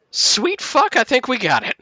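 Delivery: high, loud burst of amazed triumph; Maggie. Acted. Host to the party after the ritual, loudly elated. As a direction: exclaim it high and loud, thrilled. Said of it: "And Maggie's there, and she'll be like".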